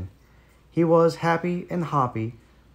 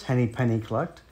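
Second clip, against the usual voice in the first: ordinary delivery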